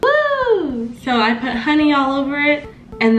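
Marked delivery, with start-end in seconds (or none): none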